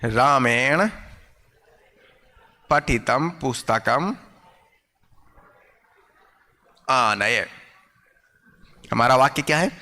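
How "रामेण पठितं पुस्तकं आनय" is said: slow, word by word with long pauses; slow dictation. Shown as a demonstration. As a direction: dictate it so the class can write it down. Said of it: "teacher dictates the example word by word".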